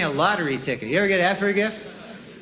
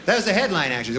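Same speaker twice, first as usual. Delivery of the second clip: usual speaking voice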